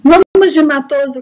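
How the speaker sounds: loud, eager announcement; excited. Real, not acted. Teacher announcing the next author to the class, loud and eager. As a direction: burst in with energy to launch the next topic. Teacher loudly starting new topic.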